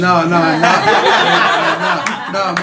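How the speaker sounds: slow, laughing denial. Real, not acted. Laughing off the host's question, slow laughing no's.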